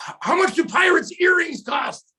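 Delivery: high shouted question; coach's cheer call. Retold or imitated. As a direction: holler it out to the team. Coach leading a sideline cheer.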